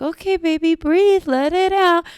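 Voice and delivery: hammed-up calm-down voice, pitched high; mock soothing cop. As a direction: coo at her like a too-gentle officer. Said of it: "They were just like".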